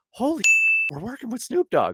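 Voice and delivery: high, giddy gasp of awe; can't believe who's in the room; retold starstruck thrill; starstruck disbelief. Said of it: "the rest of us were kinda".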